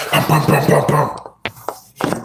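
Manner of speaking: spoken pounding on a door; imitated police knock. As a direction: mimic heavy knocking in quick bursts. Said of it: "She be knocking like the police".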